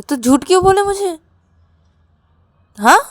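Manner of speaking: high, loud, scolding demand; angry confrontation. Acted. Rahul confronts Ravi's lie, angry and loud. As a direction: demand an explanation, voice shooting up.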